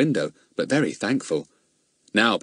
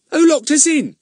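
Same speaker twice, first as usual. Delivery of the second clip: puzzled policeman; 7 dB louder than usual. Acted. Companion asks in story.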